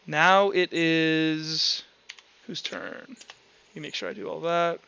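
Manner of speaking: ordinary talk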